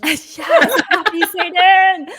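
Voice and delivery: slow, loud, high hammed-up cheer; playful over-the-top hail; mock cheer. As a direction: draw it out like a gleeful salute. Jokey cheer at a panelist's name.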